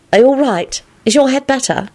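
high, lightly voiced girl; concerned girl. Elizabeth checking on Lupin's head, concerned. Woman's voice in read dialogue.

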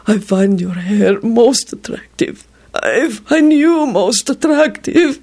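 slow, high goblin whimper; wretched whimper. A narrator's reading. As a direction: whimper it out miserably, slowly. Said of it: "it whimpered wretchedly".

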